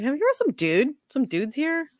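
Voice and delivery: high-pitched playful question; high mock quote. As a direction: pitch it up like a surprised bro. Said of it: "But I'm like".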